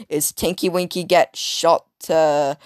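ordinary talk

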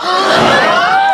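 loud shocked gasp. Retold or imitated. A loud gasp on hearing the fortune. Hearing she would die at thirty.

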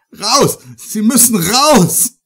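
screaming in terror; panicked scream. Acted. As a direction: shriek it out in panic. Ich schreie.

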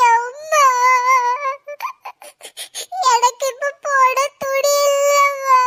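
tearful, high whining plea to mother; crying on her birthday with nothing to wear; crying child. Acted. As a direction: sob it out like a heartbroken girl. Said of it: "இன்னைக்கு இவளை அழ வைக்காத".

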